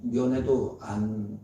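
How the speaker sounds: usual speaking voice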